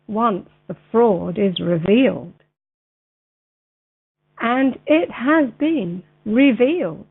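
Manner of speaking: measured reading with long pauses between phrases; slow, clear reading. Real, not acted. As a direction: read it out slowly and very clearly for a video. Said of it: "read it a little bit slower".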